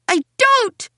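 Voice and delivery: put-on high, loud child's shriek. A narrator's reading. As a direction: shriek a guilty denial. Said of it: "I shriek".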